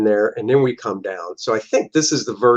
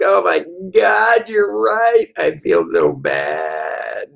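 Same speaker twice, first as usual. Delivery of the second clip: hammed-up distress; 7 semitones higher, much slower than usual. Woman realizing she was wrong, wailing high. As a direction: overplay the guilty gush. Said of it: "She's like".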